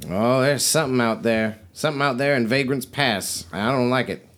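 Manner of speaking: lightly voiced wary trader woman; folksy trader, uneasy. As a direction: warn them as a folksy merchant.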